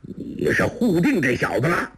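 sharp, high-pitched challenge; stern, accusing question; stern shout. A narrator's reading. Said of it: "厉声喝道".

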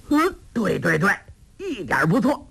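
high-pitched delighted agreement; overjoyed cry. A narrator's reading. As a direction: burst out as the idea suddenly clicks. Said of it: "大喜叫道".

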